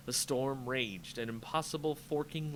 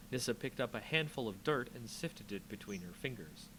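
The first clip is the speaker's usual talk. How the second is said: plain reading voice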